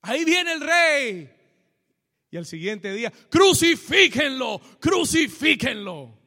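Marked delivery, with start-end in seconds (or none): 0.0-1.3 high, loud cheer of a welcoming crowd; ringing, full-voiced acclamation; crowd shouting acclaim. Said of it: "estaban gritando". 3.3-6.2 loud, slow chant of a hostile crowd; mob's cry for execution; crowd shouting condemnation. Acted. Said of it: "estaban gritando".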